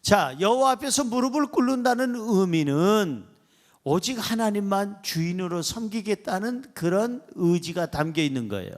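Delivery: ordinary talk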